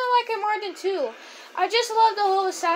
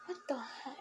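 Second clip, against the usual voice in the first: whisper; 6 semitones lower, 22 dB quieter than usual. Real, not acted. Annoyed at the game.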